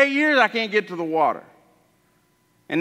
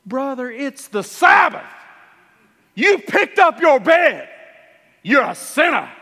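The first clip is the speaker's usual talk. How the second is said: slow, loud, accusing preacher's rebuke; self-righteous shout about the Sabbath; scolding religious leader; 7 semitones higher, 4 dB louder than usual. Acted. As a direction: drag out the scolding word by word. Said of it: "the religious leader goes".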